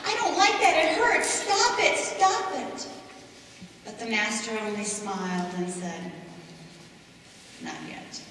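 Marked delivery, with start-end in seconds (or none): 0.1-2.9 high, loud pleading cries of a teacup in pain; desperate shouts. Acted. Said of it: "And I shouted". 7.6-8.2 calm whisper. Acted. Master answers the pleading teacup.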